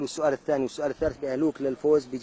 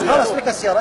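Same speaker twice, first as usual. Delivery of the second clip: loud; 6 semitones higher, 6 dB louder than usual. Acted. Host ends the argument over car.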